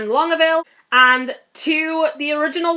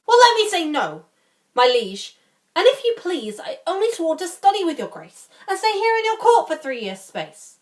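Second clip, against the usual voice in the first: higher-pitched theatrical pleading; lively courtly objection, pitched up; protesting, bargaining lord; 7 semitones higher than usual. Acted. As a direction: argue your way out of the oath. Playing Barone, bargaining with the King.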